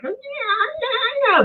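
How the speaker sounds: very high yipping; coyote cackling. Retold or imitated. Coyotes calling out of sight, imitated cackling. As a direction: imitate coyotes yelping in a wail. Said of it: "They were like".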